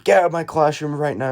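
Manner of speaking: high, loud furious order out of the room; angry teacher shout. Retold or imitated. Teacher throwing the student out of class, angry loud shout. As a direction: bark them out of your classroom. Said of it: "she's going crazy at me".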